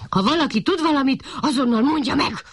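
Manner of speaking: quick, high-pitched puppy demand; puppy getting mad at the flowers; angry. A narrator's reading. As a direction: snap at the daisies in a fit of temper. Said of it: "dühödött fel a kiskutya".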